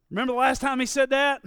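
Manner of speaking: ordinary talk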